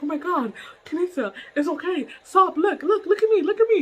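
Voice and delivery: retold urgent calming. Boss trying to calm her, urgent and rising. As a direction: imitate a boss urgently getting attention.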